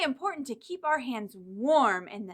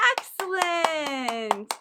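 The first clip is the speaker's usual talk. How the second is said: drawn-out praise; 3 semitones higher, 3 dB louder, much slower than usual. Acted. Praising children after song.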